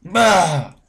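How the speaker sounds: frustrated bah. Real, not acted. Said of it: "it just frustrates me".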